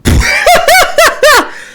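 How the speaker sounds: loud, high burst of laughter. Real, not acted. Laughing at the clip.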